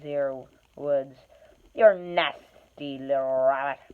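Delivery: loom over the hobbit and shake him; menacing troll. Acted.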